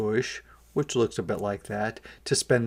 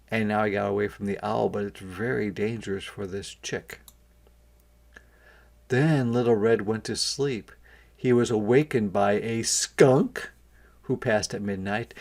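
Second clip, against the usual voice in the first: plain reading voice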